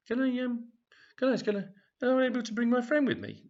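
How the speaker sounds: high, eager ladylike voice; a prim lady asking politely at the desk; Brenda's eager voice. Acted. Brenda asks the receptionist, high and eager.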